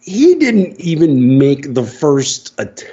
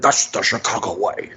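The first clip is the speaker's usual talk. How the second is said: softer, slurry Scottish-accented impression; Sean Connery; 3 semitones lower, 5 dB quieter than usual. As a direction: round it off quietly in a mock Scottish accent. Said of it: "that's the way he did".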